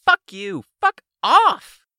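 very high, loud furious outburst; shrieked, high-pitched villainess curse; angry cursing. Acted. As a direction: explode on the last two words. Enraged villain curses the group.

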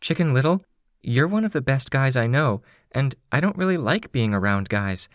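put-on high-pitched, earnest girl's voice; earnest young Candy. Candy thanks Tattletale for her help, high and earnest. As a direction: give heartfelt credit in a high young voice. Candy praises Chicken Little in dialogue.

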